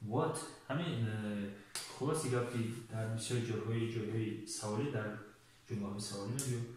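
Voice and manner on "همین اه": ordinary delivery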